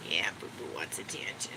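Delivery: unvoiced cooing whisper; whisper to the dog. Real, not acted. Owner whispering to her needy dog. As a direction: breathe it softly at the dog.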